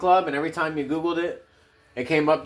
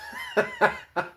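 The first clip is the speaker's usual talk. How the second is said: high laugh; 4 dB quieter than usual. Real, not acted. Laughing after Batman.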